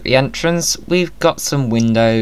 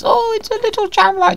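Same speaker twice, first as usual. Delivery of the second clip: cutesy squeal; faster than usual. Real, not acted. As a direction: coo at the tiny camera like it's adorable. Cooing over a cute camera.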